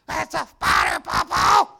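high-pitched mock panic, shouted; panicked child. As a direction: cry out like a scared little kid. Frightened boy screams to grandfather.

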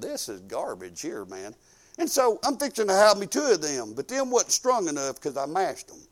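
usual speaking voice

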